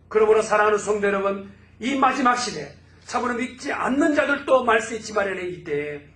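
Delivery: loud, high proclamation, stacking promise on promise; full-force preaching. Acted. Pastor proclaiming God's promised revival to the congregation, loud and high. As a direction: proclaim it like a prophecy over the church.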